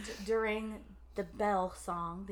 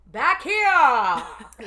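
sudden loud call, pitched up; louder; 2 semitones higher, 15 dB louder than usual. Real, not acted. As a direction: call it out from far back. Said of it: "She gets louder moving back".

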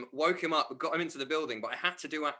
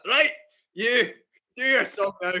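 put-on bossy Scottish accent, loud on the last words; 8 semitones higher, 9 dB louder than usual. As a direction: bark the order in a broad Scottish accent. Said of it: "you have to do a Scottish accent".

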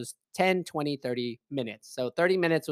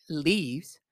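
ordinary delivery

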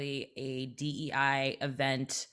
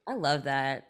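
normal talking voice

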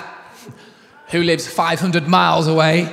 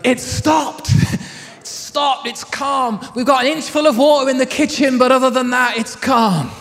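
calling down; 5 semitones higher, 3 dB louder than usual. Retold or imitated. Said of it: "As I shout down and go".

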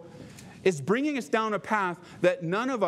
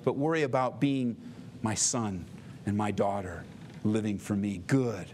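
longing God; 6 semitones lower than usual. Retold or imitated. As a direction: speak as a yearning father, gently. God's longing voiced.

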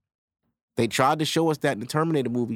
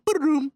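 sound effect; 13 semitones higher than usual. Retold or imitated. Mimicking a phone screen sound.

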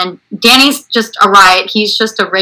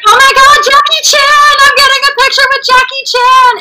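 starstruck fan. Retold or imitated. Woman thinks she's meeting Jackie Chan, high starstruck squeal. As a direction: imitate a fan shrieking with excitement. Retold fan mistakes him for star.

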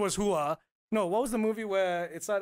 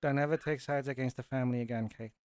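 low, restrained mobster impression; Al Pacino; 6 semitones lower, 4 dB quieter than usual. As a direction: imitate a quiet crime boss's warning, pitched down. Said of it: "talking like this in The Godfather".

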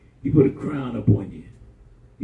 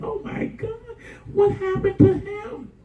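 very high, mock-aghast exclamation; stretched-out retold shock; imitating shocked onlookers. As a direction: play the horrified hospital staff, pitched way up. Acts out shocked hospital staff.

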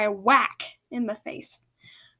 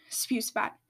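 plain reading voice

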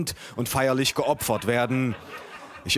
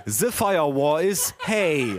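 slow, drawn-out bad English with German accent; German-accented English translation; 3 semitones higher, much slower than usual. A narrator's reading. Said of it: "übersetzte der Freund meiner Cousine". (gedehntes Englisch mit deutschem Akzent)

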